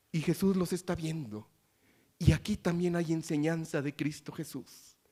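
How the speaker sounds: ordinary talk, nothing marked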